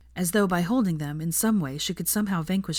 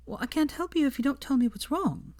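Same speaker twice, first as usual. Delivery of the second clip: higher, gentle coaxing voice; soft Hermione; 9 semitones higher than usual. A narrator's reading. Hermione softly coaxes Draco to open up. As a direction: coax it out of him softly. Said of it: "said Hermione softly".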